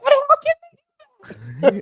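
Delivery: high, slow, drawn-out disbelief; incredulous outburst. Retold or imitated. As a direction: stretch it out in shocked disbelief. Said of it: "I'm like".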